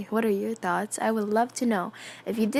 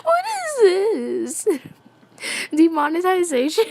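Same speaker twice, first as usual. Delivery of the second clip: mock disbelief; 9 semitones higher, 7 dB louder than usual. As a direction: stretch it out in a silly shocked voice. Mock disbelief reading fanfic twist.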